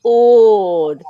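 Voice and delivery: slow, drawn-out exasperated oh Lord; mock exasperated pleading. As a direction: groan it out slowly like a put-upon sigh to heaven. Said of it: "I would say".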